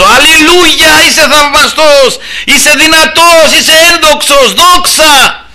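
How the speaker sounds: high, slow, full-voiced shouting; shouted praise. Preacher re-enacts shouting to the Lord alone in his car, exultant cry. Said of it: "Φωνάζω".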